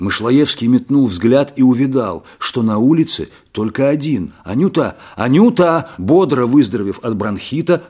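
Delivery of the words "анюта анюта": calling out. A narrator's reading. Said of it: "вскричал Мышлоевский".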